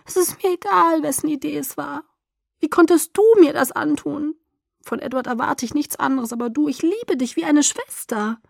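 high-pitched wounded accusation; plaintive, aggrieved complaint; hurt reproach. A narrator's reading. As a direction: accuse a friend, voice held high throughout. Hurt friend continues her reproach.

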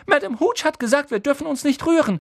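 put-on high anxious scolding girl; bossy Hermione. Hermione scolding Harry anxiously, high voice. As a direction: warn him, fretting.

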